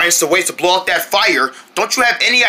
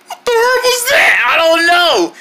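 high-pitched alarmed squawk; acted high startled cry; startled outburst; 10 semitones higher than usual. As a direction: jump in fright, voice shooting up. Startled by a noise.

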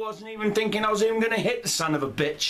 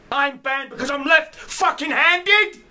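much higher and louder, yelling in disbelief; furious shout; 7 semitones higher, 7 dB louder than usual. Real, not acted. Ranting at devs who banned him, real furious yell. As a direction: explode at the absurd ban. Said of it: "You're damn right I'm fucking angry".

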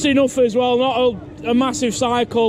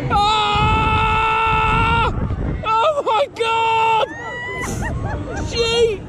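screaming and whooping on a thrill ride; high yelps of fright and glee; on a roller coaster; 8 semitones higher, much slower than usual. Real, not acted.

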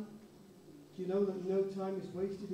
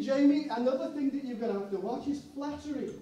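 ordinary delivery

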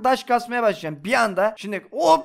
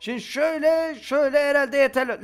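ordinary talk, nothing marked

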